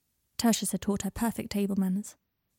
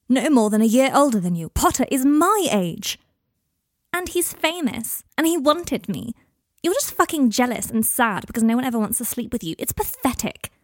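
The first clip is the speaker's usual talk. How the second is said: loud, higher-pitched angry outburst; angry shouting; 4 semitones higher, 6 dB louder than usual. Acted. Teenage girl lashing out at her guardian in a quarrel, angry shouting. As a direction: lash out, leaning hard on the key words. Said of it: "Are you done shouting at me".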